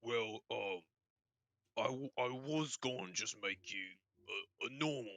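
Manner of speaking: halting orc character voice; hesitant, stammering orc smith; nervous orc. Acted. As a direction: feel your way haltingly through it. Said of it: "He, he looks at you and goes".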